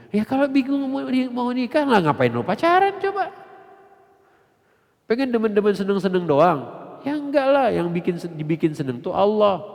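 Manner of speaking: loud, high, hammering the questions; fiery preaching. Real, not acted. Preacher rebuking dating youth, loud fiery preaching.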